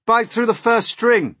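high, loud command; shouted order. A narrator's reading. James orders the centipede to bite the string, loud command. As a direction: call out an urgent order. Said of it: "James ordered".

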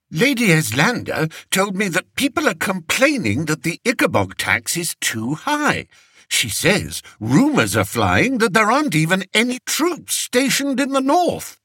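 high, fretful king voice; a spoiled king fishing for comfort; vain fretful king. A narrator's reading. Fred repeats complaints.

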